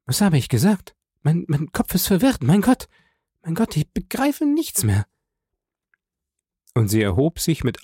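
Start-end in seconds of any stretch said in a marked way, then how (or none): 0.1-5.1 high, distraught woman's voice; acted frantic confusion; panicked bewilderment. A narrator's reading. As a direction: voice climbing into helpless panic. Wife losing her head.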